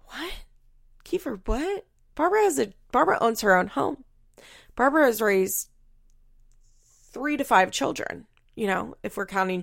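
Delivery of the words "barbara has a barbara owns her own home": ordinary delivery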